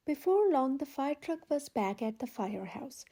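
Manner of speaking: ordinary delivery, nothing marked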